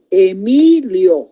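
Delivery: "Emilio" drawn out, the "mi" lengthened; slow syllable-by-syllable. Shown as a demonstration. Teacher presenting "Emilio" as an example, slowly by syllables. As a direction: sound out "Emilio" slowly for the class. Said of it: "E, una sílaba".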